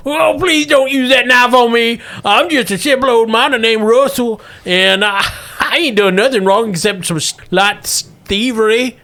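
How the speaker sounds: put-on high, pleading old miner; old prospector. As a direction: beg on your knees like an old miner. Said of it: "the miner gets down on his knees".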